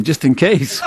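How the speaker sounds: breaks into a high laugh. Real, not acted. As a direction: laugh at your own joke. Laughs at his own joke.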